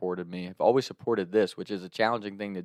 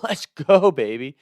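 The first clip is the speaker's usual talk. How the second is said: loud, high whoop on let's go; celebrating; 5 semitones higher, 9 dB louder, slower than usual. Retold or imitated. Friends celebrating the weed find, whooping loud and high. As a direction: cheer it out like a win.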